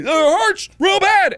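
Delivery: high loud whining complaint; pained whine. Retold or imitated. Patient whining to staff about his burnt hands, high and loud. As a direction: whine like a hurt child. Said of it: "And I'm like".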